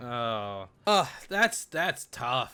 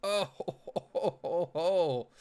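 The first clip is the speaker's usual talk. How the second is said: laughing; 4 semitones higher than usual. Real, not acted. Laughing at the scene.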